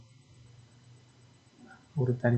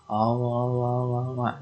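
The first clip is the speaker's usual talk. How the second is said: put-on baby cooing demo; 3 dB louder, slower than usual. Shown as a demonstration. Lecturer demonstrating infant cooing to students, mock baby coos. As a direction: imitate an infant's vague cooing vowels. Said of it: "bunyi yang dihasilkan mirip dengan bunyi vokal".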